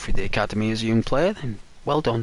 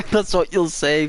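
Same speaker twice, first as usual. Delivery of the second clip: usual speaking voice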